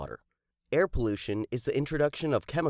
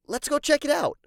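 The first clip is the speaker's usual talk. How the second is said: high, loud and keen; can't wait to go; pumped to head off exploring; eager excitement; 13 semitones higher, 4 dB louder than usual. Acted.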